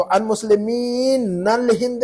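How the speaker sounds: मुस्लिमीन with long drawn "meen" before अल; stretched मुस्लिमीन, then separate अल हिंद; unjoined wrong reading. Shown as a demonstration. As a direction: keep the noon in मुस्लिमीन. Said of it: "नहीं कहेंगे अब हम".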